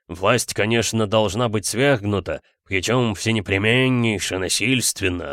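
burred, softened r's; slight burr. A narrator's reading. Geologist argues revolution with the detective, r's lightly burred. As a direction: argue like a burring intellectual. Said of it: "слегка картавил".

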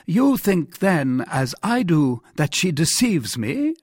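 high-pitched royal question; suspicious king. Acted. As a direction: press the minister to agree.

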